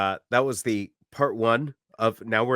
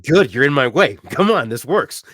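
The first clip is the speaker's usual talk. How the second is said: high, loud retort; loud comeback; 4 semitones higher, 8 dB louder than usual. Retold or imitated. Loud audience member glad the lady moves, brash call. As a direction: project it over the crowd.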